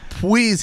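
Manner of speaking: high "Please" with a w for the l, "pwease"; squeaked baby talk. A narrator's reading. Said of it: "he squeaked".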